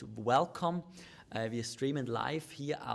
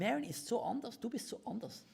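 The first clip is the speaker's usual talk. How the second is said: much higher, put-on complaint; mock exasperation; 10 semitones higher than usual. Husband voices a complaint about his wife, mock-exasperated. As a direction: lament the partner's otherness in a raised voice.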